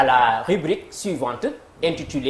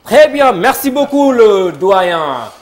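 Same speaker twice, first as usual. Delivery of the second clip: higher and louder, an emcee's burst of thanks; enthusiastic thanks; 5 semitones higher, 9 dB louder, slower than usual. Real, not acted. Quiz host thanking the dean, loud and enthusiastic. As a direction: lift it like a TV host hyping the moment.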